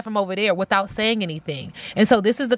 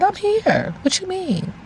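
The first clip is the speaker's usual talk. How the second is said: high, surprised little voice; high voice of her; 12 semitones higher than usual. Retold or imitated. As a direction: squeak it out innocently. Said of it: "She said".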